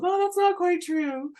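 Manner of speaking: very high, airy little voice correcting her; spirit guide voice. Pretend. Her guides cutting in to correct her, high playful voice. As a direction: squeak it out like an unseen guide chiming in. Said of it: "someone's guides went".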